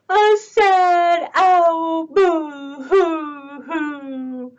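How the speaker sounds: slow, drawn-out mock weeping; hammed-up boo-hooing; sad owl. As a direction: hoot mournfully like a crying owl.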